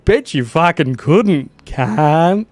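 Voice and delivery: high, loud hammed-up Aussie taunt; stupid Aussie voice. Streamer's Aussie character egging on the exile, loud mocking Aussie. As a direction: goad him about Burak like a rowdy Aussie. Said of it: "put on stupid voice".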